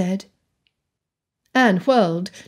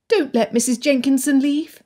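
put-on higher, pleading girl; young girl voice; 6 semitones higher than usual. A narrator's reading. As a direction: voice Anne a bit higher. Anne's voice, girl figure.

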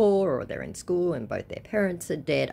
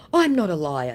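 loud, lightly put-on denial; mock protest; 3 semitones higher, 7 dB louder than usual. Scammer defending himself to the woman, mock protest. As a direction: protest loudly in a mocking voice.

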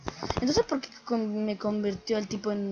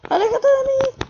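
much higher, louder shriek; shrieking in panic; 14 semitones higher, 9 dB louder than usual. Real, not acted. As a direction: scream it in full panic. Brain monster approaching.

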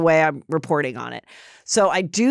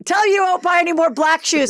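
put-on Jersey accent; 10 semitones higher than usual. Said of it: "like, Jersey accent".

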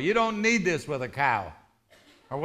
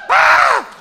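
high, very loud shriek; imitated startled woman's scream; 14 dB louder than usual. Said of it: "And she went".

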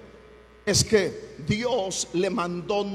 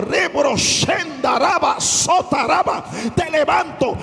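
prophetic voice; 4 semitones higher, 4 dB louder than usual. Shown as a demonstration. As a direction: cry out in tongues like a prophet. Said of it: "tú te mueves con voz profética". (voz profética gritando en lenguas)

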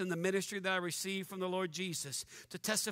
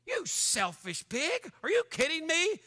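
slow scornful pig, then higher outraged question; exasperated rebuke; 6 semitones higher, slower than usual. Real, not acted. Preacher scorning the blogging pastor, slow mocking rebuke. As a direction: spit out the insult slowly.

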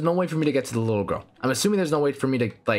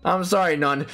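ordinary delivery

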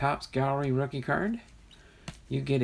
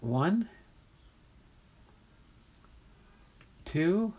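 rising, drawn-out count with pauses; building countdown; 6 semitones higher, much slower than usual. Real, not acted. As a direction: count up higher each number, building suspense. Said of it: "So drum roll".

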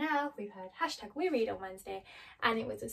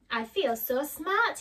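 higher, louder and slow, proud and pleased; delighted Scarecrow; 5 semitones higher, 6 dB louder, slower than usual. Acted. Scarecrow boasting to Dorothy after getting brains, delighted. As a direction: savour it like a proud new genius. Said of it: "The Scarecrow was delighted".

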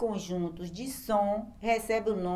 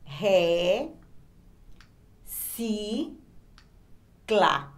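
"Ré", "si", "clá", each alone with long pauses; drawn-out syllables, one at a time; separated into sounds; much slower than usual. Shown as a demonstration. Teacher showing the same word separated in sounds, syllable by syllable. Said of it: "palavra separada em sons".

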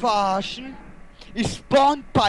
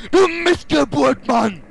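loud, high, drawn-out angry scream; raging gamer yell; freaking out; 6 semitones higher, 6 dB louder, slower than usual. Real, not acted. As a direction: scream the insult slowly at full rage. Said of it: "Du rastest also immer so aus".

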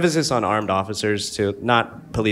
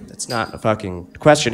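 ordinary talk, nothing marked

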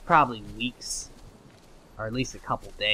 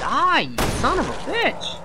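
loud, high, drawn-out curse; combat yell; 9 dB louder, slower than usual. Real, not acted. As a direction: shout it at the enemy you are attacking. Fighting an enemy in game.

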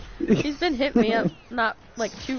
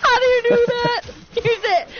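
squealing through laughter; laughing high; 11 semitones higher, 5 dB louder than usual. Real, not acted. Teasing a friend's laugh, laughing high. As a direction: ask while cracking up.

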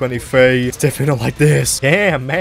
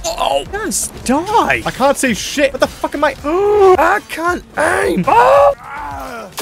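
panicked shouting; 13 semitones higher, much slower than usual. Real, not acted. Panicked mid-fight, can't aim.

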